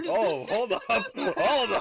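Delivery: loud, high laughing outburst; excited. Real, not acted. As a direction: burst out with sudden glee. Sudden laughing outburst mid chat.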